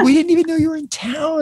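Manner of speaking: high amazed exclaim; surprised. Retold or imitated. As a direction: voice the coworkers' surprise. Coworkers surprised he came.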